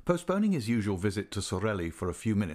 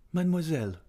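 put-on raised, higher-pitched young man's address; 8 semitones higher, 3 dB louder, faster than usual. A narrator's reading. Embarrassed young man addressing the singer, higher character voice. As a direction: lift the voice for a lightly acted suitor. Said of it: "he said".

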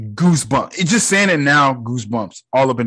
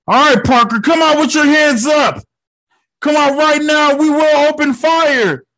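loud, high shouted command; shouting police officer; 12 semitones higher, 5 dB louder than usual. Acted. Police calling Parker out with hands up, shouted. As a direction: order the suspect out at full voice.

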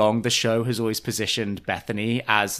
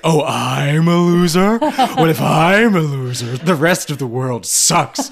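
put-on slow, louder, drawn-out drunk indignation; drunk Luann imitation; 4 semitones higher, 7 dB louder, slower than usual. Luann drunk, called a loser, slow loud indignant mock. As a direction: ham up a tipsy diva taking offence.

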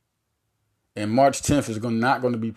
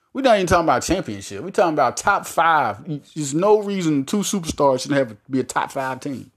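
normal talking voice

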